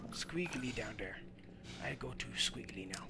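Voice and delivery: low secret whisper. Acted. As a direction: hush it like a sneaky creature. Whispered repeat, then odd voice.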